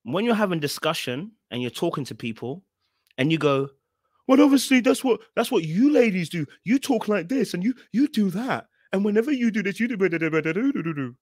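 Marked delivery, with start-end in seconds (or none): 4.3-11.2 higher, louder mock confrontation; hectoring put-on rant, easing at the end; disrespectful rah-rah. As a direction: imitate someone talking over people, running into 'do, do, do'. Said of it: "you're actually disrespecting a person".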